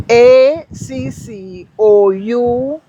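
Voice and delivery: slow letters A C C O U T, spaced and deliberate; spelling it out. Shown as a demonstration. Guest spells the French word for the host, slow and deliberate. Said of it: "for the French".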